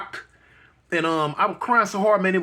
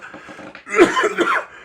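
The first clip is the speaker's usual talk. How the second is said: sharp, high choking cough; 4 dB louder than usual. Real, not acted. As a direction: cough hard, throat caught. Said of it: "I'm choking".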